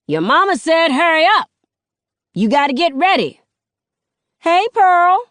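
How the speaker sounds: high, loud neighborhood holler; hollering grandmother. A narrator's reading. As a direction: shout it so the whole street hears. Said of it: "People two streets over probably hear her".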